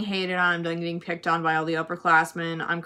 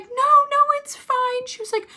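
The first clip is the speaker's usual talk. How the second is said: very high, cooing imitation; mock-comfort a crying student way up high; put-on high consoling voice; soothing staffer; 13 semitones higher than usual. Imitates woman soothing crying girl.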